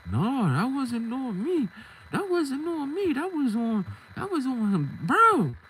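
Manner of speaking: high, drawn-out denial; acted protest. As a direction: imitate someone pushing the blame away. Accused man denies blame, retold.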